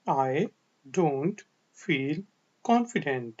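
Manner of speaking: one word at a time, with pauses; slow repeat-after-me. Shown as a demonstration. Teacher asks learners to say it along, word by word. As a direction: dictate it slowly for learners to echo.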